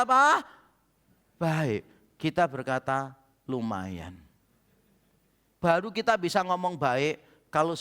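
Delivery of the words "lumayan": low, soft, barely impressed; a reluctant "Lumayan"; grudging. Acted. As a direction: mutter it like it's merely okay. Grudging verdict on God's work.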